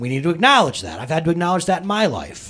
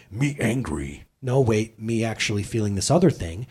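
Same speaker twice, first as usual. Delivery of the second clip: low, soft caveman-style voice; caveman self-talk; 7 semitones lower, 4 dB quieter than usual. Pretend. Pastor acting out naming the real feeling, caveman-style. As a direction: talk like a simple caveman catching himself. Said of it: "look at truth and go".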